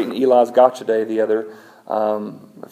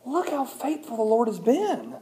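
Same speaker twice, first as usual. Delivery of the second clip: awed; 12 semitones higher, 7 dB quieter than usual. Retold or imitated. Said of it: "I was like".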